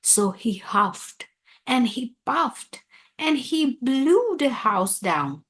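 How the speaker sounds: usual speaking voice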